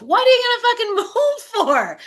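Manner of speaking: high-pitched, incredulous outburst with a laugh; exasperated retort. Acted. Speaker challenges people who say they'll move, exasperated retort. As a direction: shoot up high in disbelief.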